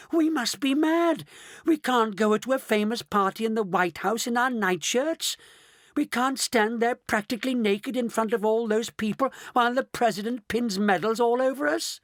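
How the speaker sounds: a mock panic at being seen naked; horrified old woman. A narrator's reading. Grandma Josephine fretting about the party, high and mock alarmed. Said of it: "she screamed".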